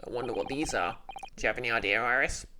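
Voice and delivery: low voice, puzzled; a deep-voiced young man thinking aloud; puzzled male sidekick. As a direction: sidekick voice, pitched low and wondering. Male character voice.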